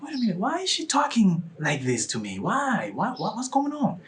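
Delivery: high-pitched retold bewilderment; bewildered. Inviter wondering at her reaction, high and bewildered. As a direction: act out confusion, voice lifted high.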